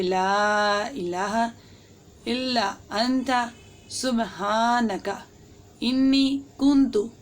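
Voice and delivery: very slow, each word spaced and fully pronounced; the right way, unhurried and precise; slow careful recitation. Shown as a demonstration. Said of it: "proper तलफ़ुज़ के साथ पढ़ना है".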